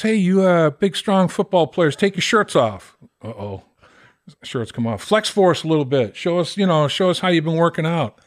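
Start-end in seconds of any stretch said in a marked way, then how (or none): 0.0-3.0 higher, slow commanding coach voice; mock coach barking orders. Coach ordering football players to strip, mocking bark. 5.0-8.2 mock coach taunt.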